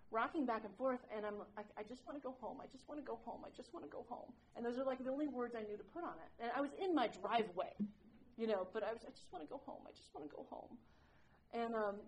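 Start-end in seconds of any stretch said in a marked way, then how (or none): 1.8-4.3 tearful plea. Retold or imitated. Retelling crying meltdown in driveway. 9.0-10.8 whispered, tearful plea, much softer; whispered pleading to go home; broken whisper. Retold or imitated. Retelling sobbing in driveway.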